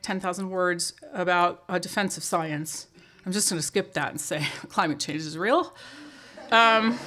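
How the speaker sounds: ordinary talk, nothing marked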